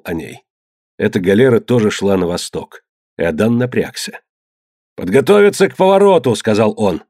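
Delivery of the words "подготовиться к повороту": loud raised order; commanding call. A narrator's reading. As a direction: call the order across the deck. Order to crew.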